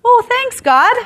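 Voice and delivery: high, loud sarcastic gratitude; mock thanks. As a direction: thank him sarcastically, about to burst out laughing. Mock reply to unwelcome news, laughing.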